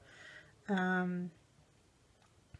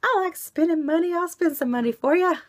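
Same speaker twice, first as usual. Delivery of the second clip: excited; 10 semitones higher, 7 dB louder than usual. Real, not acted. Playful shopping confession.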